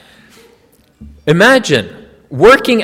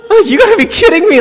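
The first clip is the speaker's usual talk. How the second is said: much higher and louder, incredulous; disbelieving outburst; 13 semitones higher, 5 dB louder, faster than usual. Real, not acted. Student recalling the professor's cruel joke, high incredulous outburst. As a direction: blurt it out in stunned disbelief.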